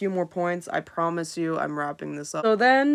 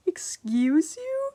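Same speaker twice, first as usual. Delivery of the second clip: mock offended; 12 semitones higher, much slower than usual. Mock offended at being called short.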